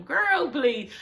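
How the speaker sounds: ordinary talk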